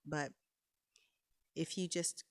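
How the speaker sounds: ordinary talk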